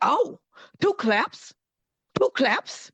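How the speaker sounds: high, slow, delighted echo of a new idea; delighted man. Retold or imitated. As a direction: imitate his thrilled surprise, drawn out. Man delighted by his new role.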